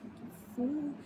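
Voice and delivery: hushed, high-pitched fake agony; mock pained. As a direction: squeak it out softly, as if just kicked. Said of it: "kicks him so hard in the nuts".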